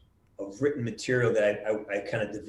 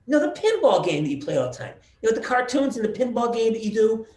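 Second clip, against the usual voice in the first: imitating mom; 10 semitones higher, 4 dB louder than usual. Mom on phone, voiced.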